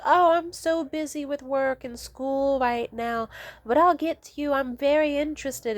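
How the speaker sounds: high, mocking imitation of a liar; fake excuse. Uninterested date stringing someone along, high mock-sincere imitation. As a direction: sing out a phony excuse. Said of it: "guilt trips or lies".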